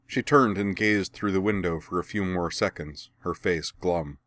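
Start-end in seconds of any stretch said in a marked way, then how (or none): none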